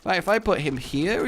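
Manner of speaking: ordinary talk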